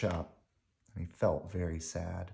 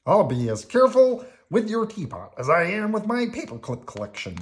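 high, loud, earnest character voice; proud, emphatic puppet voice; Bert; 12 semitones higher, 8 dB louder than usual. A narrator's reading. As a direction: promise eagerly, pitched well up. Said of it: "said Bert".

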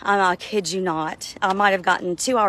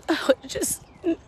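high, breaking cry, slowed; words cracking into a wail; sobbing out; 10 semitones higher, 3 dB quieter than usual. Real, not acted. Weeping while recounting night.

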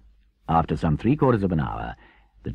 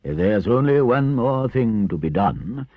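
slow, louder gratified Badger; raised, measured, pleased with himself; 7 semitones higher, 6 dB louder, slower than usual. A narrator's reading. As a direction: pronounce it with mild satisfaction. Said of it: "continued the gratified Badger".

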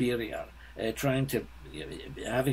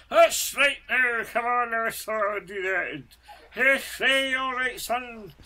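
Scottish impression, higher, louder and slow; a hammed-up Scot barking orders at his son, drawn out high; Scottish accent; 11 semitones higher, 5 dB louder, slower than usual. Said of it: "where he would imitate a Scotsman".

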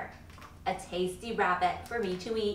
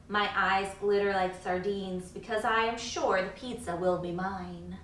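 plain reading voice

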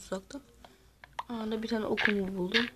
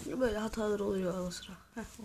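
ordinary delivery

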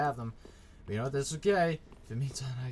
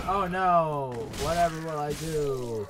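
mock dismay; 3 semitones higher, 5 dB louder, slower than usual. Player taunting game enemies who threaten him, mock dismay. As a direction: feign helpless distress, drawn out. Mocks enemies after challenge to fight.

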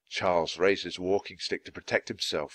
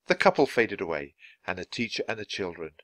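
ordinary delivery, nothing marked

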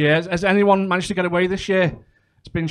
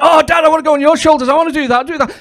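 put-on high kid's plea; whiny child; 9 semitones higher, 5 dB louder than usual. Pool kids begging their dads to copy the game. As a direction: kid pestering dad. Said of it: "they were like".

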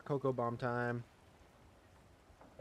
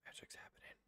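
whisper; 26 dB quieter, faster than usual. Real, not acted. Dropping cocoa bomb in.

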